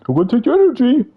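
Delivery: mock the boss gleefully stealing energy; a hammed-up cartoon baddie taunt; mocking villain voice. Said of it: "As soon as he spawned".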